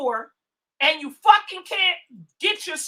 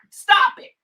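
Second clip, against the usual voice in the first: sharp stop it; 6 semitones higher, 7 dB louder than usual. Real, not acted. Snapping at a troll.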